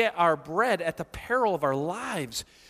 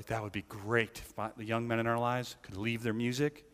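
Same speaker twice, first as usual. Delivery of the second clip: a mock-wishful aside, said under the breath; low murmured aside; 10 semitones lower, 9 dB quieter than usual. Preacher voices congregation members, soft mock-wishful aside. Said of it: "Some of you are like".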